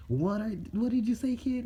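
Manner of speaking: high, loud mock outrage; indignant challenge. Offended fox Kurama to the kid, indignant challenge. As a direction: pitch it way up and demand an answer.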